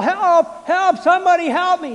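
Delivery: high, loud acted cry for help; full-voiced retold distress call; yelling for help. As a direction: shout desperately to a distant shore. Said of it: "I started to yell".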